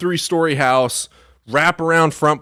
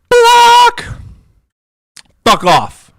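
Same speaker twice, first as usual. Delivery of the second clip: sudden, much higher and louder shout of one word; 8 dB louder, much slower than usual. Real, not acted. Streamer blocking a spamming chatter with a high yell. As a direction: yell it out as you hit the button.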